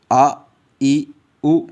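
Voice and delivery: आ ई उ said plainly without a jerk or stretch; teacher showing students how to read आ ई उ; isolated vowel sounds. Shown as a demonstration. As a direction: say आ, ई, उ evenly, not drawn out. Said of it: "आपने भी ऐसे पढ़ना".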